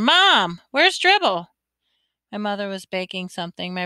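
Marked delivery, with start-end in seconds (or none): 0.0-1.5 urgent raised, higher call; hollering. A narrator's reading. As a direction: holler for Mom from across the house. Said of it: "rushed to the kitchen and hollered".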